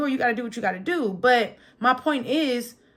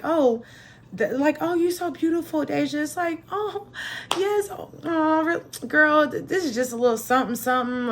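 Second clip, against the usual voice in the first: self-deflecting; 5 semitones higher than usual. Retold or imitated. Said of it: "I used to have a thing".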